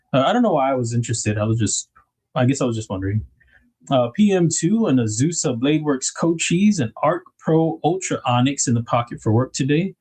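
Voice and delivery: usual speaking voice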